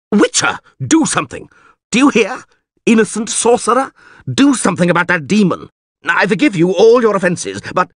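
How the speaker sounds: high, loud angry tirade; furious rant. Acted. Mayor to the bald priest, furious and panicked.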